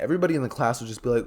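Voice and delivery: ordinary delivery, nothing marked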